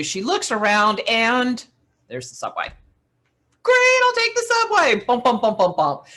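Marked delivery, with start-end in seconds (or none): 3.6-5.0 much higher and louder, pitch held up; a bright burst of relief; relieved excitement. Retold or imitated. Sudden idea amid the panic. 5.1-6.0 bumping sound. Retold or imitated. Suitcase down subway steps.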